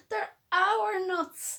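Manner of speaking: high, slow indignant exclamation; exclaimed. Acted. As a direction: protest as the nuts fly away. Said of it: "exclaimed Bruce".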